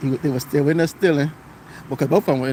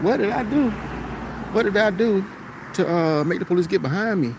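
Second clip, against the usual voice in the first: retold disbelief, pitched up; baffled protest; 6 semitones higher than usual. Retelling baffled inner reaction to police.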